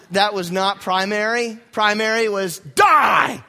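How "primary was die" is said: slow, loud hammed-up shout; mock shout. Preacher hams up his wish for the wasps to die. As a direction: bark it out in mock vengeance.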